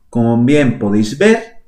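ordinary talk

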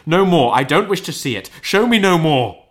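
high, loud desperate plea; anguished cry. Acted. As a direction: beg at full voice. Scrooge crying out continued.

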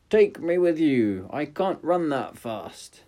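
high, loud frog plea; pleading frog. Acted. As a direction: beg her to wait. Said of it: "cried the frog".

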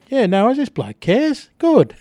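high, delighted "good" to finish; touched and pleased. Retold or imitated. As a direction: play it moved that the bloke cares. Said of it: "I felt really good".

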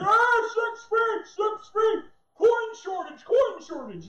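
high, loud, slow panicky shriek; scared masked man. Retold or imitated. Mocking scared shopper crying six feet at preacher. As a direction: wail like a terrified shopper. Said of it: "But he was like".

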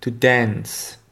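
to dance in American English; "To dance" with a flat a, "dæns". Shown as a demonstration. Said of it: "écoutez bien la différence avec le A N". (en anglais américain, "dance" avec un a plat)

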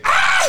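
sudden high falsetto shriek; a short, high, playful squeal. Real, not acted.

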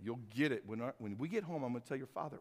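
a hint of a warning mother, hushed; low hushed threat from a mom to her son; lightly voiced mom scolding under her breath; stern mom. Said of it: "that mom's look at their young boy that was like".

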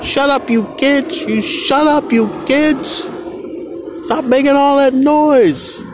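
shrill-pitched imitation of a fed-up parent; mock parent yelling. Parent yelling at the noisy children, hammed-up mock yell.

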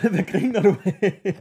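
high, tight voice on a huge breath; speaking on an over-full breath; lungs overfilled with air. Real, not acted. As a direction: gulp in too much air, then start reading. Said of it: "det var for meget luft".

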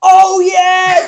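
sudden loud, high "oh yeah"; excited shout. Real, not acted. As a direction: blurt it out as you suddenly remember. Forgot the most important thing.

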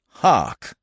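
loud, sudden call; ringing stage exclamation; cried. A narrator's reading. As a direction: call everyone to listen. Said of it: "he cried".

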